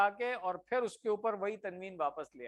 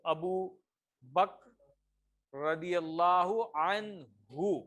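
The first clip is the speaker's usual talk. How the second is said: slow reverent honorific; much slower than usual. Shown as a demonstration.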